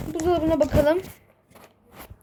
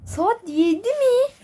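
shocked; 9 semitones higher, slower than usual. Acted. Late for the match.

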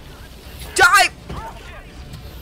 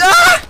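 sharp high yelp, much higher; high startled yelp; 8 dB louder than usual. Real, not acted. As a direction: yelp in sudden alarm. Startled in firefight.

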